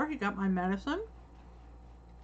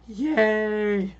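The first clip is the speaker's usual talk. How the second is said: cheering; 8 dB louder than usual. Real, not acted. Cheering over drugs.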